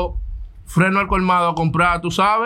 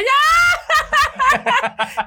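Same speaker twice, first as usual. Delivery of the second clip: squealing laugh, much higher than his voice; high-pitched burst of laughter. Real, not acted. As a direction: break into a high helpless laugh. Laughing at his own story.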